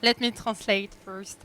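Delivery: ordinary talk, nothing marked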